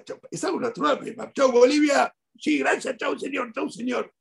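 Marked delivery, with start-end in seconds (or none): 1.3-4.1 mock goodbye exchange. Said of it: "Se llamó Bolivia".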